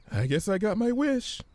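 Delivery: timid Fluttershy. Acted. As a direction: ask carefully, unsure. Fluttershy voice held.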